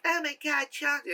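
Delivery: a hint of a scared little girl; girl's first voice. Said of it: "speak in two different voices".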